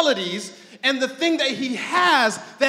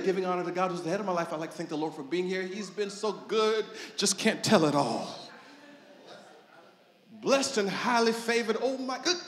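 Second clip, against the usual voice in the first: churchy mask talk; 5 semitones lower, 6 dB quieter than usual. Retold or imitated.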